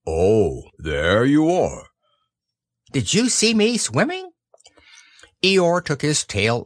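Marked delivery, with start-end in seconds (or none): 0.1-1.9 slow, unimpressed drone; gloomy donkey. A narrator's reading. Eeyore finally notices Roo, glum. 2.9-4.3 high eager squeak; excited little child. A narrator's reading. Roo asks Eeyore about swimming, eager squeak.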